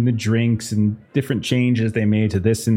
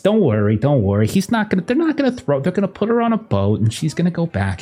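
higher, gushing hostess cooing over Maxie; doting socialite; 6 semitones higher than usual. Acted. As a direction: coo over him, soothe the guest. GM voicing doting Evelyn.

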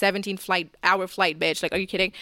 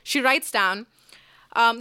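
normal talking voice

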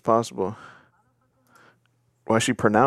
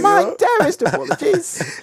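very high, slow mock British apology; British accent; slower than usual. Imagined pet apologising grandly, slow high British accent. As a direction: draw it out in a silly British accent. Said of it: "I was thinking a British accent".

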